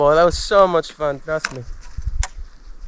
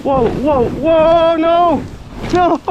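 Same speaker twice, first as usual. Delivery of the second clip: excited yelps; 8 semitones higher, much slower than usual. Real, not acted. Sledding downhill, losing control.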